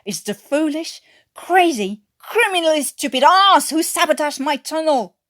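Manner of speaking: loud, high enraged snarl hurled at the crew; a boss raging over his wrecked tunnel. A narrator's reading. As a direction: spit every insult out in fury. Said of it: "he snarled".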